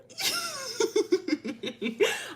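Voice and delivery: high, sustained real giggles; giggling fit. Real, not acted. Storyteller cracking up mid-story, high helpless giggling. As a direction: dissolve into squeaky laughter.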